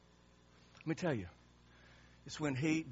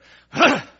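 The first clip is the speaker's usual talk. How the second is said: loud, sudden snort of anger; angry snort; 10 semitones higher, 19 dB louder than usual. Acted. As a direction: snort hard with rage, like an outburst. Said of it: "Snorted anger".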